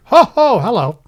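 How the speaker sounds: loud high ho ho hello; booming hello. Real, not acted. Host testing a fixed mic with a loud hello. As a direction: belt out a jolly hello into the mic. Greeting into newly working mic.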